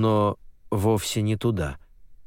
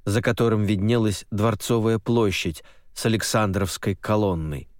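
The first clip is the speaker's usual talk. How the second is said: ordinary delivery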